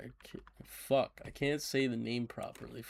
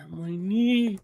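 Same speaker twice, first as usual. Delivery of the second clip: higher, louder pained groan; 9 semitones higher, 9 dB louder than usual. Real, not acted. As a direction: moan it out in pain. Hurt knee.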